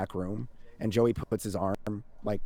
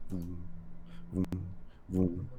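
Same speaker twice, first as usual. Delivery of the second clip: low, soft vroom in spaced pulses; imitated pulsing hum; 4 semitones lower, 6 dB quieter, slower than usual. Game master voicing a green pulse, low soft hum. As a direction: hum out a faint, steady pulse.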